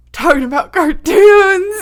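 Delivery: high, loud laughing words. Real, not acted. Laughing through the intro line.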